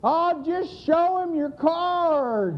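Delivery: high, slow, drawn-out shout; mocking holler. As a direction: holler a smug retort across the yard. Man shouts back from the porch.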